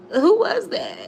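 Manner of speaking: high, loud, suspicious demand; a hint of jealous suspicion; pointed question. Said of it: "just looking at him like".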